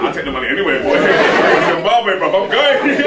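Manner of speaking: loud, laughing imitation of a greedy kid; cracking up as he plays the eager audience; laughing mock quote. Speaker mimics audience grabbing the money, laughing loudly. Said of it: "People are like".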